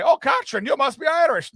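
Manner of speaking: high, loud hammed-up Irish charmer; mock Irish guy delighted by a name. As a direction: gush like an eager Irish barfly. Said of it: "one Irish guy".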